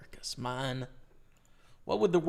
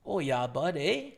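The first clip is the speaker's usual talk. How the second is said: Canadian accent; 3 dB louder than usual. Shown as a demonstration. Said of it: "Where's your Canadian accent?".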